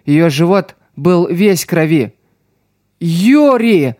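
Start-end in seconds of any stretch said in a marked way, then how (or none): 3.0-3.9 a cry of her name in panic; yelling a name. A narrator's reading. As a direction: call out in alarm. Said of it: "заорала Алиса".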